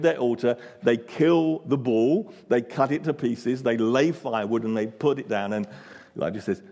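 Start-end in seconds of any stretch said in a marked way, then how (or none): none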